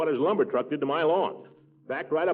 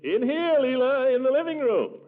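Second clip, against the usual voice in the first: much higher and louder, calling across the house; 13 semitones higher, 4 dB louder, slower than usual. Acted. Man calling the visitor in from the kitchen, loud. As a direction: call her through from the next room.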